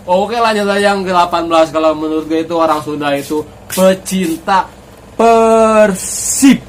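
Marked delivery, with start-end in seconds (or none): 3.7-6.6 loud, drawn-out club cry; rousing shout. Real, not acted. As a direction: belt out the club name proudly. Fan announces his beloved club. (teriakan lantang menyebut klub)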